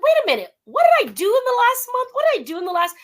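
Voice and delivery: high, fast, alarmed "wait a minute"; sudden high-pitched panic; startled realization. Retold or imitated. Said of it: "And I'm like".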